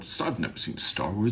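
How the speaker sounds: believable cluelessness. Pretend. Pretending to people about Star Wars, believable cluelessness. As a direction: play it down so it sounds true. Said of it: "try to make it really believable".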